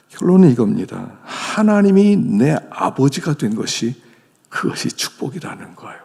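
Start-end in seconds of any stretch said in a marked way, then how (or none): none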